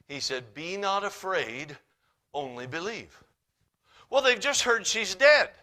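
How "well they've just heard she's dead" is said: ordinary talk, nothing marked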